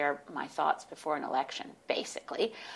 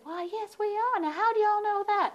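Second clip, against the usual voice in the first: mock Arkansas Southern accent, pitched way up; 4 dB louder than usual. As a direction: play the friendly Arkansas tourists with a Southern accent. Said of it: "they had this soft Southern accent".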